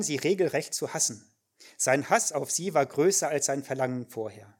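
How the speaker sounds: ordinary talk, nothing marked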